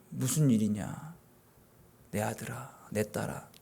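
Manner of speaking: hushed and slow, like a father leaning down; tender fatherly God. Retold or imitated. Pastor voices God gently asking a crying child, slow and soft. God answering the weeping believer.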